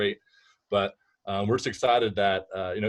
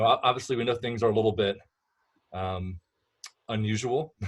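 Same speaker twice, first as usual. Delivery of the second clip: ordinary delivery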